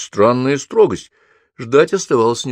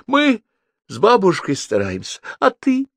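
tearful reproach, pitch raised; mildly acted weepy complaint; through tears; 12 semitones higher than usual. A narrator's reading. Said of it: "сквозь скорые, будто летняя дождь, слёзы".

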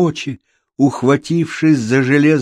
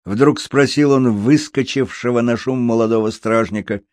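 ordinary delivery, nothing marked

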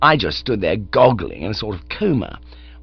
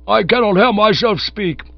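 higher, loud exasperated yell over the noise; 10 semitones higher, 7 dB louder than usual. Acted. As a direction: yell to be heard over howling cats. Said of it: "yelled Sir Roderick".

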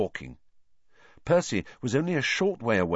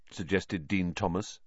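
plain reading voice

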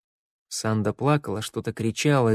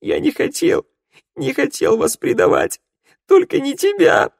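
very high, loud sobbing plea; acted sobbing, high and louder; 6 dB louder than usual. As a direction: wail out the denial through tears. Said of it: "рыдал Раду".

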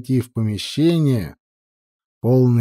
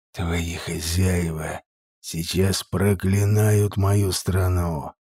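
cold rasping evil emperor; 4 semitones lower than usual. A narrator's reading. Evil interrogator sorcerer speaking.

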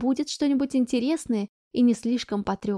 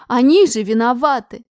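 loud protest; 9 dB louder than usual. Acted. Daughter protests to mother.

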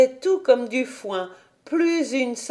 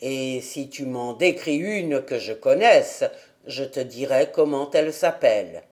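put-on lower, gruff old man; old grandfather; 7 semitones lower than usual. A narrator's reading. Grandfather answers Heidi about the flowers, lower old man's voice.

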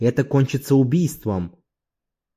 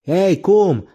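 fierce hail, pitched up; 7 semitones higher, 3 dB louder than usual. A narrator's reading. Ambusher hailing his old foe from the reeds, fierce. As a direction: call out fiercely, higher than usual. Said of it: "раздался свирепый голос".

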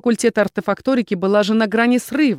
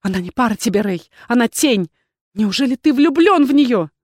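plea building higher and louder; tearful outcry; 4 semitones higher, 5 dB louder than usual. A narrator's reading. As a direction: protest in hurt disbelief, rising to a cry. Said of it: "закричала она сквозь слёзы".

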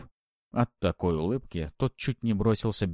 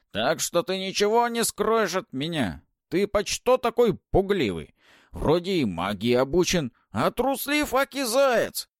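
высокий медленный скрипучий голос ведьмы; многозначительно, как всезнающая старуха; голос Бабы-Яги; 12 semitones higher, 6 dB louder than usual. Acted. Баба-Яга стыдит гостя. (скрипучий голос старухи)

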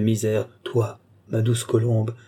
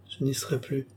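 much softer, sadly murmured; softer, mournful murmur; 2 semitones higher, 8 dB quieter than usual. Acted. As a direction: let it fade to a murmur. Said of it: "murmura-t-elle tristement".

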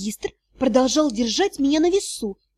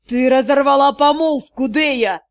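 angry hiss; 5 dB louder than usual. A narrator's reading. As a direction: hiss it out in anger. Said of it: "магистр прошипел".

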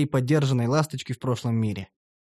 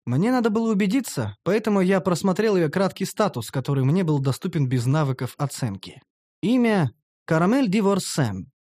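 usual speaking voice

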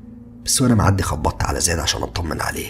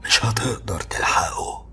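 slow ghostly whisper; calm eerie warning; 5 semitones higher, 4 dB quieter, much slower than usual. A narrator's reading. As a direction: let the old man's threat creep out slowly. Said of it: "واتكلم بكل هدوء".

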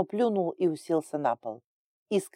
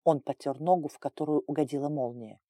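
usual speaking voice

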